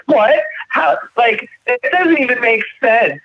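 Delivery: high-pitched imitation of a flustered girl; flustered disbelief. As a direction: act out her baffled protest, voice jumping up. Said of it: "she starts getting, like, way flustered".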